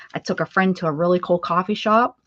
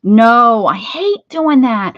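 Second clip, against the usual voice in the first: loud, drawn-out complaint; loud whining protest; 3 semitones higher, 11 dB louder, much slower than usual. Real, not acted. Crafter refusing her housemate's request to separate meat, loud protest. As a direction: whine loudly against the chore.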